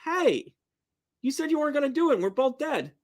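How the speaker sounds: high-pitched protesting frog voice; complaining frog. Acted. Frog confronting the scorpion in heaven, high protesting voice. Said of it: "And then the frog said".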